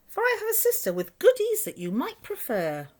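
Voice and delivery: high put-on duck voice; sly duck. A narrator's reading. Duck tempts the rat to the cave, high sly voice. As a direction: lure him in sweetly. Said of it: "quacked the duck".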